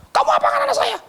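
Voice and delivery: high, indignant accusation; accusing voice. Retold or imitated. Official confronting the preacher over the children, high and indignant. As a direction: demand it, pitched high and offended.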